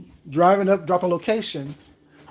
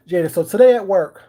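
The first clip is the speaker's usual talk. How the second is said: ordinary talk, nothing marked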